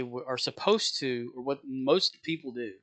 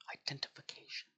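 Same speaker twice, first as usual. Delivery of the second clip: whispered exasperation; 2 semitones higher, 13 dB quieter than usual. Retold or imitated. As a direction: mouth the word in a tired whisper. Exasperated repeat.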